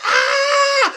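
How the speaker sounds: very high comic shriek; mock scream. Orb right in his face, mock scream. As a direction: scream high in hammed-up terror.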